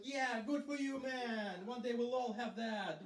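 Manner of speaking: high, drawn-out cheer of support; encouraging cheer. Retold or imitated. Comedians call support to a colleague on stage, high mock-heartfelt cheering.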